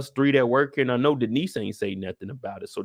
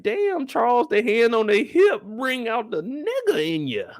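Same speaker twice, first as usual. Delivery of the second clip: high mocking voice; 10 semitones higher than usual. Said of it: "It's like".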